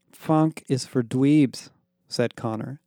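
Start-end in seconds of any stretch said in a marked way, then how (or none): 0.1-1.7 slow, sneering put-down; slow dismissive. Acted. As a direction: say it slowly, looking down on it. Connor dismisses funk.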